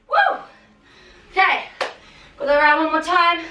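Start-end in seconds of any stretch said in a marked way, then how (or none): 0.1-0.4 excited woo. Real, not acted. Cheer after countdown.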